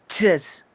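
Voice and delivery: "chets" starting with t and ch combined; loud model of "chets" with a hard t-ch onset; T-plus-CH demo. Shown as a demonstration. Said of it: "una t combinada con una ch".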